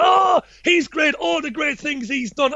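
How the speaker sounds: high, slow, gushing mock fan voice; hammed-up fawning fan. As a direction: parody a starry-eyed follower. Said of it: "people going".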